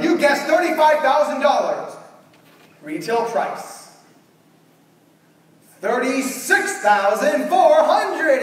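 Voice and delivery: loud, slow announcer with sung price; host dramatically announcing the retail price; game show reveal. Retold or imitated.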